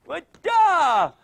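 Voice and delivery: high, loud drawn-out shout; excited cheer. Real, not acted. Coach to a kid in the drill, excited cheer.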